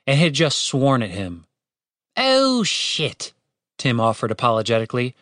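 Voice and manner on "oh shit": put-on higher voice with mild British accent; apologetic British accent. A narrator's reading. Tim apologises to the wounded bird, British accent. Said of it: "remembering to speak in a British accent".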